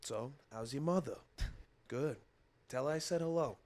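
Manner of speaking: soft Boston murmur; Mark Wahlberg impression. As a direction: whisper it like a Boston tough guy. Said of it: "the Mark Wahlberg, He, like, whispers".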